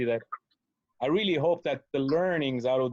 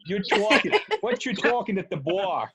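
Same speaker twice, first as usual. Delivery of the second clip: mock Jersey tough-guy accent; imitating a New Jersey accent; 4 semitones higher, 3 dB louder than usual. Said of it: "I love your New Jersey accent".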